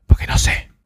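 breathy ASMR whisper. Acted. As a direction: whisper close to the mic. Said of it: "estamos haciendo A S M R".

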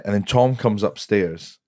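ordinary delivery

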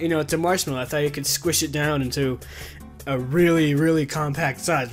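normal talking voice

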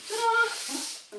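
high, loud sing-song ta-da. Real, not acted. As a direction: trill it like a showgirl. Second entrance flourish.

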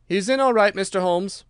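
eager shout of a lad reporting; cried out. A narrator's reading. Street boy reporting to Holmes, calling out loud.

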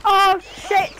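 loud, high frantic cursing; panicked yelling. Real, not acted. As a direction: yell in alarm at the game. Danger in the game, cursing.

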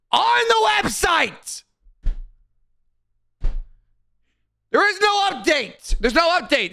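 real high-pitched exasperated yell; amped-up exasperation. Real, not acted. Streamer fed up with chat asking for updates, exasperated yelling. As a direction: shout it way up high, stretching the key words. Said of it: "I'm getting fucking amped".

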